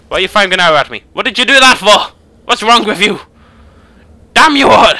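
outraged skull shouting high and loud; skull yelling. Pretend. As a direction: scream at the archer, furious and shrill. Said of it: "I need a name for a skull".

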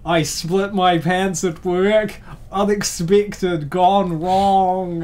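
loud, high, slow clickbait announcer; clickbait video title voice. Pretend. Mocking a clickbait title in a loud, drawn-out hype voice. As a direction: hype each word like a video thumbnail. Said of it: "I can see it now. Emily looking shocked at the thumbnail".

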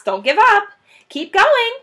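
high, loud urging call; shouting. Acted. Boggis shouting to Bunce and Bean. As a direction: yell across to the others. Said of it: "the fat Boggis shouted".

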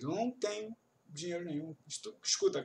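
ordinary talk

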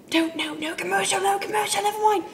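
excited. Real, not acted. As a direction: call off the break in a rush of excitement. Excited cancelling the break.

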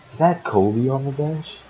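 high, loud disbelief at the bench; real astonished question; surprised. Real, not acted. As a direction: blurt it out in shock. Spots Kobe on the bench.

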